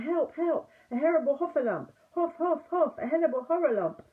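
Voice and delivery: high, loud, fast terrified babble; panicked crying out. A narrator's reading. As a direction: stumble over the words in blind panic. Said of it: "crying out".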